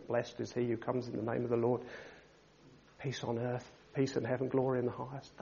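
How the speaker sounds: timid mumbled worship; mumbling. Pretend. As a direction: mutter it half-heartedly under your breath. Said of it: "can you, mumbling".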